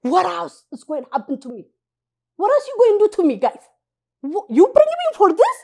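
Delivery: loud, high frustrated wail; exasperated outcry. Retold or imitated. Newcomer protesting to the translators, exasperated. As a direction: complain loudly to everyone.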